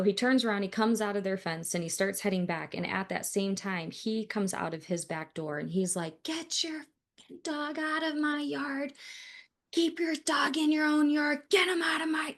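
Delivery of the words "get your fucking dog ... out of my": high-pitched mock angry yell; imitated screaming neighbor. Angry neighbor to dog owner in his yard, imitated screaming. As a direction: imitate an irate man yelling over the fence. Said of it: "he's just screaming at me".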